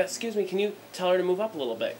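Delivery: the polite request again with irritation creeping in; irritated repeat. Retold or imitated. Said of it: "'Cause I was getting a little pissed".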